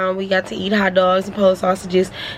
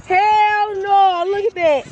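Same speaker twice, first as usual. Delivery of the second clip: high, laughing disgusted "hell no"; high squealing disgust at the bugs; grossed out; 11 semitones higher, much slower than usual. Real, not acted. Bugs under tree, speaker recoils.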